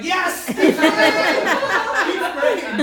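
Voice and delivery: put-on the kid cheering on the hat, high and loud; excited cheer.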